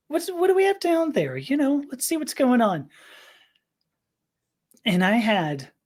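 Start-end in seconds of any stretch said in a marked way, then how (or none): 0.1-2.9 high-pitched, playfully curious musing. Retold or imitated. Thinking aloud alone in the house, playfully curious. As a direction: lift the pitch, nosing around with mock innocence. Voicing own curious thought before exploring.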